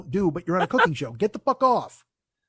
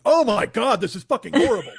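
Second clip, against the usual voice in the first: louder laughing disgust; mock disgust; 3 semitones higher, 6 dB louder than usual. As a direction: gag at the taste, laughing. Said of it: "I'd be like".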